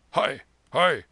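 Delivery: put-on loud, startled old soldier; startled shout. Old soldier leaping up from sleep, startled shout. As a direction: cry out as if jolted awake. Soldier wakes to child's yell.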